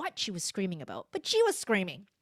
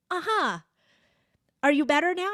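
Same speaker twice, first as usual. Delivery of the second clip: high, loud, perky check-in; encouraging parent; 8 semitones higher, 7 dB louder than usual. Retold or imitated. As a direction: re-enact cheering on a little child. Mother checking on calming child.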